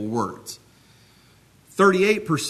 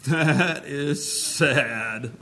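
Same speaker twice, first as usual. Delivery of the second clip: "That is sad" extremely slow, every word stretched; hugely drawn-out "That", then a long "sad"; exaggerated inflection; much slower than usual. Shown as a demonstration. Preacher demonstrates a third meaning, "That is sad" exaggerated and very slow. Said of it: "Just with the inflection of my voice".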